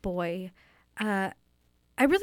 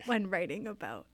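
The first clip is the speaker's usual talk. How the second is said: mock choked-up, softer and halting; getting emotional; 6 dB quieter than usual. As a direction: falter softly as if overcome mid-sentence. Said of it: "I'm getting emotional".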